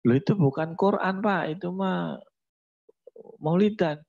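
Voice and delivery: high exasperated mother; a mother setting it straight, pitched high; correcting mother. Acted.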